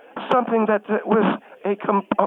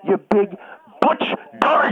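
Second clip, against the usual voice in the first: demon voice. Acted. Refusing to be called names, sudden demon-voiced insult. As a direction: erupt into a demonic snarl on the last words. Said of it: "sounded like the very demon".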